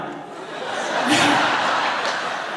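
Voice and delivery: breathy rising laugh. Real, not acted. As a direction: laugh at the absurdity. Laughing at her own story.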